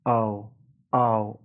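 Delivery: او glided from a to u; the ow sound of shout, drawn out; ow diphthong. Shown as a demonstration. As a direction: slide او from open a into u. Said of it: "به این صدای ترکیبی او".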